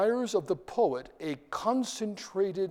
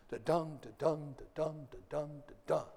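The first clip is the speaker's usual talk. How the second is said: soft da-DUN, stress on the second syllable, fading quieter each time; iambic beat; 7 dB quieter than usual. Shown as a demonstration. Teacher counting iambic feet for the class, fading da-DUN. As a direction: chant the weak-strong beat, trailing away. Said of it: "So weak syllable, strong syllable".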